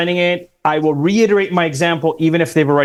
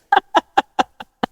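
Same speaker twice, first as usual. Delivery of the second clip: high laugh. Real, not acted. Laughs at own joke.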